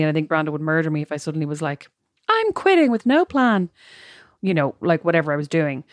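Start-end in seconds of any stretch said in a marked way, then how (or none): 2.3-3.7 high, drawn-out mock announcement; dramatic declaration. Woman imagining suddenly announcing she quits, mock dramatic. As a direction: blurt it out slow and high, hamming it up.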